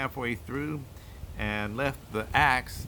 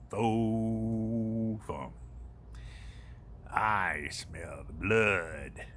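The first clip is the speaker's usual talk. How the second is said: low, slow, booming giant chant; 3 semitones lower, 3 dB quieter, slower than usual. Acted. Old giant sniffing for Jack, low and slow. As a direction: drag out each word like a huge giant.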